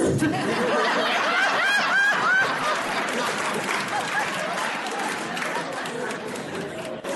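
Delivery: high, long burst of real laughter. Real, not acted. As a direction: collapse into laughter. Trading roles, long laugh.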